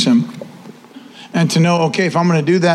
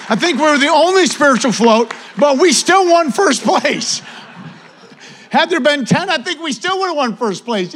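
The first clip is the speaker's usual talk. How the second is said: playful boast; 8 semitones higher than usual. Real, not acted. Joking boast about only float.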